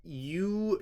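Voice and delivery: ordinary delivery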